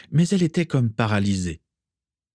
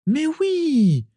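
high, drawn-out gushing yes; eager agreement; 3 dB louder than usual. A narrator's reading. Isalia approving what another pupil said, eager agreement. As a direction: agree emphatically in a much higher voice. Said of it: "approuva Isalia".

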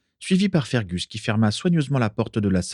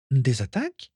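higher, questioning surprise; astonished; 5 semitones higher than usual. A narrator's reading. Daisy puzzled by Carla's accusation, surprised. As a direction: query it in innocent wonder. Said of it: "s'étonna Daisy".

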